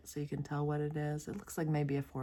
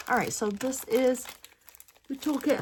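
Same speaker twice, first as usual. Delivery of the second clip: yawning; 8 semitones higher, 5 dB louder than usual. Real, not acted. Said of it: "I'll do this yawning thing".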